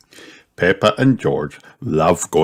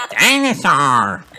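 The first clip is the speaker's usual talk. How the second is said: put-on high, drawn-out child's cry; mild shout; 8 semitones higher, slower than usual. A narrator's reading. Piglet at duck pond, mild high shout. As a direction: call the word slowly, pitched up. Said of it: "shouts George".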